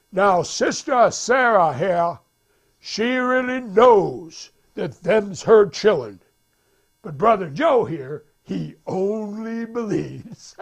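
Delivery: slow, high, emphatic preacher punchline; prédicateur. Retold or imitated. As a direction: draw out each contrast from the pulpit. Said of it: "the preacher says".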